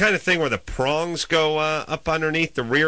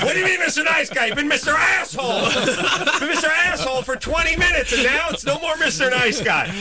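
incredulous retort. Retold or imitated. Retorting to driver in story.

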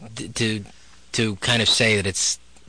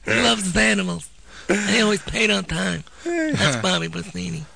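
much higher-pitched mobster vouching for a pal; Godfather-style character; 9 semitones higher than usual. Acted. Comic testimonial for animal trainer Bobby, high mob-character voice. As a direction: put on a high Godfather-movie voice. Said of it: "named after characters in The Godfather".

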